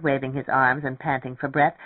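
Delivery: plain reading voice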